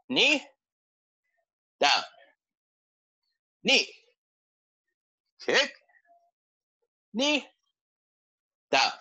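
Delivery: high, sharp called commands; drill commands. Acted. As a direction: call each move out crisply. Leads a kicking drill.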